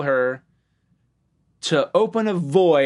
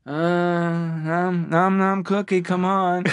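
put-on higher, drawn-out pleading with a baby; desperate Bob; 4 semitones higher, much slower than usual. Desperate dad tempting the baby with a cookie, weary pleading. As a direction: plead wearily, stretching the sounds. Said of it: "The desperation in his voice".

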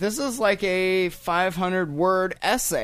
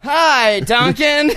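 support group greeting; 8 semitones higher, 6 dB louder, much slower than usual. Pretend. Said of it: "You wanna pilot this with me here?".

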